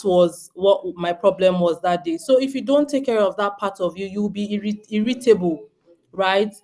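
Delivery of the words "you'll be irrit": ordinary talk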